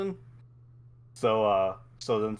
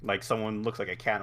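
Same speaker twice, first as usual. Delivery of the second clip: ordinary talk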